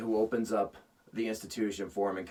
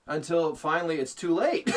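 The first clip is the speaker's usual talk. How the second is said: usual speaking voice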